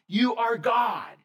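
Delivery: slow, big declaration; preached climax. Acted. Climax of Peter's confession.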